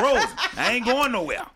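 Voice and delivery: high, laughing grandpa impression; old granddad voice. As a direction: tell her off like an old man. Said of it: "He said".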